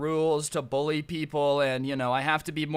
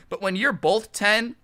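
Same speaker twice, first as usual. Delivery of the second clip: normal talking voice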